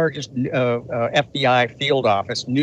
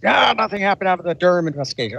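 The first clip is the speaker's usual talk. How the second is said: higher, quick mock dismissal; mocking naysayers; 7 semitones higher, faster than usual. Speaker imitating everybody who dismisses the Durham investigation, mildly mocking. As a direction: lightly mimic people brushing it all off.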